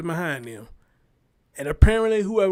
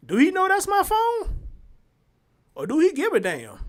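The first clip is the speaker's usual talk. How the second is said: indignant; 11 semitones higher than usual. Real, not acted. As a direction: sound scandalized, pitch leaping up. Indignant question.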